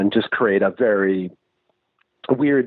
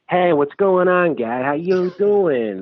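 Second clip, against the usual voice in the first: mock over-the-top Boston accent, high and drawn out; exaggerated Boston guy, pitched high; thick Boston accent; 7 semitones higher, slower than usual. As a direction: ham up a slow, thick Massachusetts greeting. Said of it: "super thick, like".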